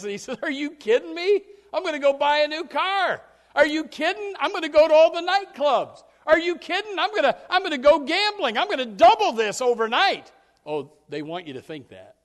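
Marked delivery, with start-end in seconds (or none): 0.4-10.3 loud, high, brash bragging; cocky boasting. Acted. Prodigal son waving his cash, loud cocky boast. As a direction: brag with cash in hand.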